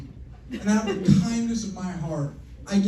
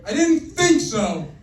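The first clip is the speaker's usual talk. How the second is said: smug; 5 semitones higher, 4 dB louder, slower than usual. Acted. Dad asserting dominance.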